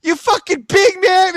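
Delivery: high, loud mocking challenge; retold angry taunt. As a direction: turn back and goad him. Turns back to taunt the neighbor.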